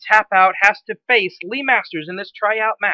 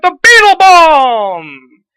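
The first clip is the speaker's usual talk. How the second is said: slow, drawn-out, much louder and higher shout of the move name; excited shout; 7 semitones higher, 12 dB louder, much slower than usual. Acted. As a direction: stretch it out like the big finisher just landed. Finisher hits, commentator erupts.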